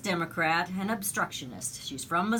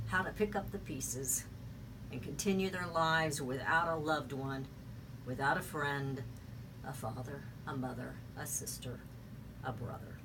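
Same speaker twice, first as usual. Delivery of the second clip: hushed, lower, grave tribute; solemn condolence; 5 semitones lower, 7 dB quieter than usual. Real, not acted. Radio host mourning shooting victims with families, solemn and hushed. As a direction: let each loss fall softly, with pauses.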